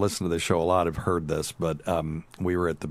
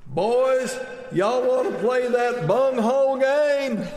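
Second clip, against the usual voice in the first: mock holler, very high and drawn out; slow, high-pitched comic yell; 4 dB louder, slower than usual. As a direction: bellow across a crowded beach as mother-in-law. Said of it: "she yells".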